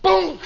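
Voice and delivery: short high, loud "boom"; winch bang. Retold or imitated. Imitating the winch tearing free, abrupt boom. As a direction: pop out a sudden bang. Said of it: "the winch goes".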